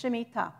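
Shemitah ending on a stressed "tah"; stress on last syllable. Shown as a demonstration. Teacher correcting the emphasis for listeners, Shemitah stressed on its final syllable. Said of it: "the emphasis is on the last syllable".

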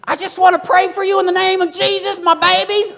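very high, loud, excited preaching cry; fiery preacher shout. Retold or imitated. Delynn preaching to the church, a high fiery shout. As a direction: shout it up high over the congregation. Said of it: "He's like".

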